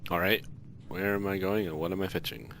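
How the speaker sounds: usual speaking voice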